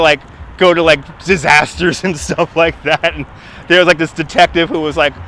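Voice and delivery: ordinary talk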